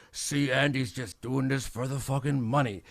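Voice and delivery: a light dismissive sneer as the critics; mocking the crowd. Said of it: "and everyone's like".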